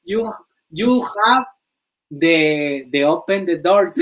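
plays the bad English speaker proudly; hammed-up English. Showing fans his broken English, high and hammy. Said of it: "practicando mi inglés".

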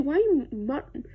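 usual speaking voice

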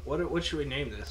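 usual speaking voice

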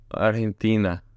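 Argentina with the g as a Spanish h; Argentinian accent. Shown as a demonstration. Host testing how locals say it, Argentinian accent. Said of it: "if Argentinians do that accent".

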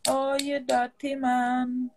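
very slow, drawn-out teasing; mock disgust. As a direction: stretch every word to mock him.

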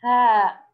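هاء loud, with a long drawn aa; sound named clearly. Shown as a demonstration. Teacher names the pausal sound for pupils, هاء loud and drawn out. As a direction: stretch and stress هاء as the answer. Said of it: "تنطق".

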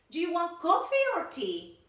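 "coffee" pushed up high, "tea" dropping down; loud, high model question, up on "coffee", down on "tea"; rising then falling intonation. Shown as a demonstration. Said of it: "rising intonation and falling intonation".

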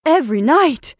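loud, high startled question; alarmed question. Acted. Sister hearing of the nightly shadow, alarmed. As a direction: echo it in alarm. Horrified echo of sister's news.

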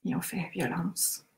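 quiet, paced EFT tapping words; soft tapping recitation. Acted. Leader tapping with the group, soft and paced. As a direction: murmur each point gently.

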